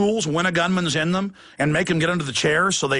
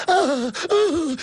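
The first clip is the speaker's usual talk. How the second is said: high, hammed-up whimpering gasps; mock panicked gasps; 8 semitones higher than usual. Imitating state police in fright, mock gasping. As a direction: gasp in pretend terror. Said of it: "I have seen state police going".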